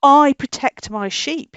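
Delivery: ordinary delivery, nothing marked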